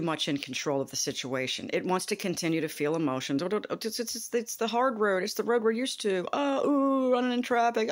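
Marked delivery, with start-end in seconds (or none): 6.3-7.9 mock panic. Acting out panicked body.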